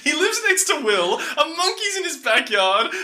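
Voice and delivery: high, laughing disbelief; reading his notes through laughter; laughing exasperation. Retold or imitated. Host reading out his own notes on the put-upon dad, laughing. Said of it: "This is the point where I've written down".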